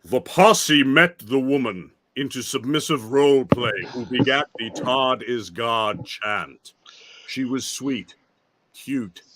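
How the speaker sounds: slow, measured mock-grand recitation; dramatic book reading. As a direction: read the passage out with heavy ceremony.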